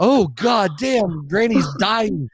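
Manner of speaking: high, loud, drawn-out wail; mock alarm. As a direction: stretch each word in exaggerated dismay. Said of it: "It would be like".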